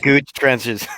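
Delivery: ordinary talk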